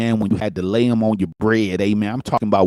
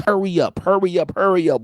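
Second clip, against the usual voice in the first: higher, nagging hurry up; fussing at siblings; 6 semitones higher than usual. Retold or imitated. Kid hurrying siblings to McDonald's, higher nagging voice. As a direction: nag the little ones to move faster. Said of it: "you will be fussing at your siblings".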